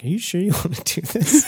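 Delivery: a hint of a doubtful pawnbroker, half laughing; pawn shop guy. Pawn shop clerk doubting the broke customer pawning his laptop, lightly voiced.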